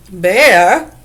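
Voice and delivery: Bear, said loud with a heavy Arabic-style accent. Shown as a demonstration. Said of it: "like the word bear with a heavy accent".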